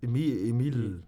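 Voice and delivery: "Emil, Emil" with the final L tested and held; trying double L. Shown as a demonstration. Co-host attempts Emil with two L's, trying double L. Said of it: "Med to L'er til sidst?".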